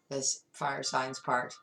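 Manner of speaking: ordinary talk, nothing marked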